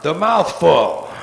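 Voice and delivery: punchy mock sign reading; deadpan punchline. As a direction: drop it like a sign. Punchline restaurant name.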